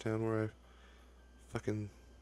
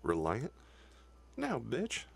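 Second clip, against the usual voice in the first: mocking the game; 6 semitones higher, slower than usual. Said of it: "and it just said".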